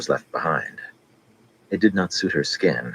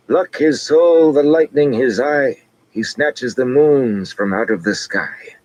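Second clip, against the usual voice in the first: high, loud, slow chanted recital; old fool reciting verse in a high chant; reciting prophecy; 10 semitones higher, 6 dB louder, slower than usual. A narrator's reading. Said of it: "the fool began to recite".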